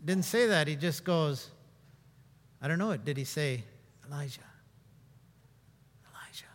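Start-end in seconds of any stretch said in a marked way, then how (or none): 4.1-6.4 whispered name, fading to nothing; soft unvoiced whisper of Elijah; whispered call. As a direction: barely breathe the name. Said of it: "it was loud enough for Elijah to hear".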